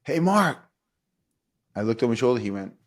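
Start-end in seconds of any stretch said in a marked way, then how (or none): 0.0-0.6 loud high call across the field. Retold or imitated. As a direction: call his name sharply, higher. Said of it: "and he said".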